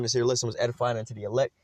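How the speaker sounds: normal talking voice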